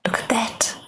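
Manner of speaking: hushed whisper; soft awed whisper; whispered look at that. Acted. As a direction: breathe it in awe. Narrator hushed at the spell.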